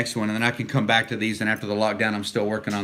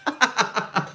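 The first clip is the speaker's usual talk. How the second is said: high laugh; 11 semitones higher than usual. Real, not acted. Laughs at funny comment.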